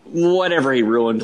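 ordinary talk, nothing marked

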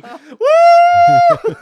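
high, loud whooping cry; whooping shout. Retold or imitated. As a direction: whoop with glee while flying. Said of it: "in the air going".

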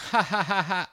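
high, hammed-up fake laughter; mock laugh. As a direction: imitate someone's clueless high laugh. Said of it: "you'll laugh like this".

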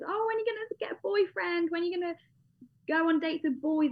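high, teasing nagging relative voice; hammed-up family pestering her; mock nagging family. Family teasing her about boyfriends, high nagging voice.

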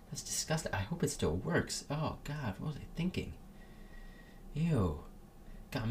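recoil, soft and high; disgusted muttering. Real, not acted. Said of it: "I just spat all over my mic".

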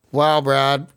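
normal talking voice

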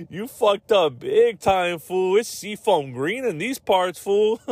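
mock gangster taunt. Gang member mocking magenta rival, slow high taunt. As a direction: gloat slowly like a cartoon thug.

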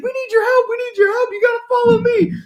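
pleading mock female falsetto; Ian in panic. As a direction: urge them on in a squealing drunk panic, hammed-up. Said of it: "full panic".

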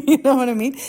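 fast, high, laughing through it; giggling as the question tumbles out; real laugh, quick and bright. Real, not acted. Laughs while telling.

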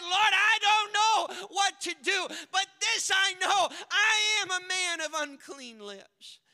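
high, loud pleading confession; desperate cry to the Lord. Acted. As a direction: shout it as a man laid bare. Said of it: "he just said".

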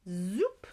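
rising vanishing-trick sound; magic wand zoop. A narrator's reading. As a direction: imitate a wand zap, swooping up.

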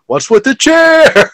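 high, slow, loud wail; hammed-up cry of disbelief; mock bewildered yell. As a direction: yell it drawn out, imitating the wrestler. Re-enacting the wrestlers' exchange.

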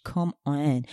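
usual speaking voice

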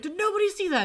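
high, loud retold freak-out; kinda freaking out. He asks whether others saw it, freaking out. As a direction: blurt it in high-pitched disbelief. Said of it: "kinda freaks".

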